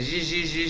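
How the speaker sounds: buzzy repeated zh sounds; imitated filling noise. Shown as a demonstration. Host describing filling a crowler, buzzy sound effect. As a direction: mimic a machine with short zh bursts.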